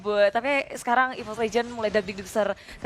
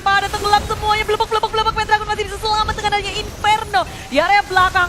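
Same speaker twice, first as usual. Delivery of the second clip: excited play-by-play; 7 semitones higher, 4 dB louder, faster than usual. Acted. Hyped live esports call.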